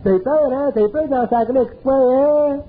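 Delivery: high mocking taunt of the little voice; little inner voice. The little inner voice mocking a scared man, high taunting. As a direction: taunt him like a sneering inner voice. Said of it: "elle dit".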